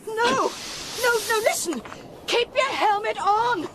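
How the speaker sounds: frantic pleas; urgent pleading. Acted. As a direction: stop him before it's too late. Begging him to keep helmet on.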